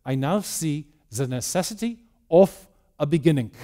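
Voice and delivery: put-on a light German accent, quoting Einstein; lightly put-on German accent, measured and solemn. Lecturer quoting Einstein to the press, in a light German accent. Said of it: "in his heavy German accent".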